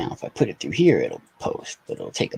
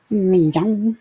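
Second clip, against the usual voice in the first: much higher, louder meow; meowing at cat; 9 semitones higher, 6 dB louder than usual. Pretend. Owner calls her cat with a loud high meow.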